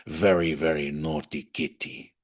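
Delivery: put-on lightly done low Spanish-accented purr; a hint of a suave Puss in Boots voice; Antonio Banderas. Imitating the lawyer like Antonio Banderas to viewers, lightly purred. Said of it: "sounds like the way Antonio Banderas speak".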